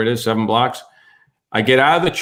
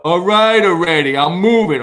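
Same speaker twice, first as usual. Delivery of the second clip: loud, higher singsong taunt; mocking mimicry; 4 semitones higher, 5 dB louder than usual. Man across the street parroting the driver, mocking. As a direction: throw the words back, raised and mocking.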